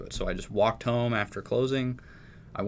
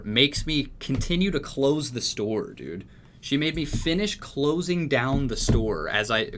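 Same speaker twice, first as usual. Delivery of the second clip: ordinary delivery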